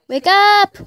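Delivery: building to a loud high shout; from a call to a full shout; shouting wake up. Real, not acted. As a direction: yell it to wake the dog. Trying to wake sleeping dog.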